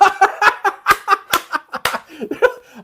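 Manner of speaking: high, loud burst of laughter; cracking up at the golfers; real loud belly laugh; big laugh. Real, not acted. Golfers running from storm.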